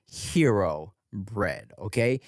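casual host ad read, unhurried. Acted. Host reading a sponsor ad to listeners, easygoing pitch. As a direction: sell the bread like a friend.

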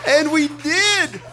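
high, laughing insistence. Real, not acted. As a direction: keep laughing as you drive it home.

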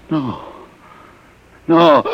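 sudden horrified cry; terrified gasp. Acted. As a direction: panic at the news. Refugee shocked by humanoids news.

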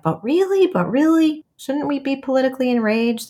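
high-pitched, disbelieving questions; incredulous protest. Retold or imitated. Young student questioning the meditation teacher, high incredulous protest.

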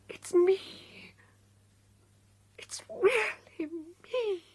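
slow awed whisper. Acted. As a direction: whisper it in wonder. She accepts who she is.

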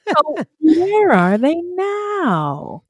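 very slow, high, drawn-out title call; playful segment announcement. Pretend. Co-host announcing the closing segment, drawn out and high. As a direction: stretch each word like a teasing jingle.

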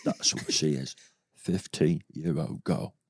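low, soft, very slow impression; hushed, halting mock-Caine voice; Michael Caine. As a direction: draw it out quietly like a British movie star. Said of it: "Is that what he sounded like?".

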